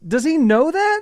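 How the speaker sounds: very high, startled query; incredulous question. Retold or imitated. Husband asking whether their son knows, high-pitched disbelief. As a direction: retell it as a thrown dad.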